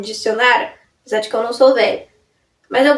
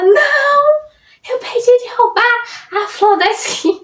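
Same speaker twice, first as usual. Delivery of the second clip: mock dismay; 9 semitones higher than usual. Friend's dismayed reply, retold.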